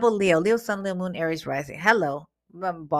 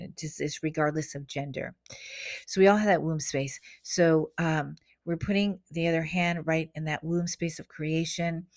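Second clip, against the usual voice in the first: normal talking voice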